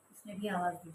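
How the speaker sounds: ordinary delivery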